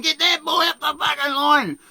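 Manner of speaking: high loud skipper yell; mock scream. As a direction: bark the order across the deck. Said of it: "[name]'s screaming".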